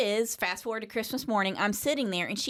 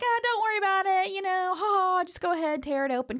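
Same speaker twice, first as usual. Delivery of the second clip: higher, fast breezy reassurance; mock cheerful dad; 8 semitones higher than usual. Dad waving off the torn box, breezy. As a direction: brush it off like a jolly dad. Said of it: "your dad's like".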